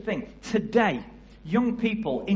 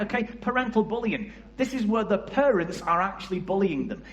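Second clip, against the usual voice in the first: ordinary delivery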